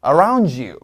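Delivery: around you said as aroun-ju; D and Y linked as j. Shown as a demonstration. Teacher models the linking for students to repeat. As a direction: blend d and y into j. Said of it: "약간 지읒 소리로 바뀌어요".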